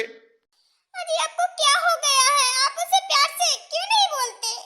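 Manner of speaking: high, loud wounded wail; hurt pleading. Acted. Hurt wife pleads in quarrel.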